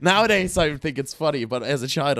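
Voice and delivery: normal talking voice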